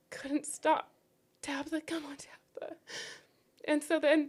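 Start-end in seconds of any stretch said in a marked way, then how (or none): 1.4-2.8 pleading whisper, fading to nothing; a mother whispering to her unconscious child, pleading; desperate whisper. Retold or imitated. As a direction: whisper her name, begging her to wake.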